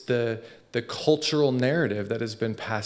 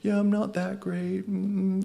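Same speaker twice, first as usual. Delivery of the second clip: a hint of a shy, self-deprecating voice, softer and higher; meek and timid; 7 semitones higher, 4 dB quieter, slower than usual. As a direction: shrink yourself, play it small. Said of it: "meek and timid and like".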